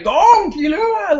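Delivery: high, slow, stretched-out yell of the names; excited shout. Retold or imitated. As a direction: call the two names in shocked amazement. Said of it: "he was like".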